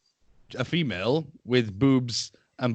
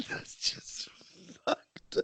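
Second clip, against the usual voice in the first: laughing whisper; 15 dB quieter, much slower than usual. Real, not acted. Laughing in disbelief.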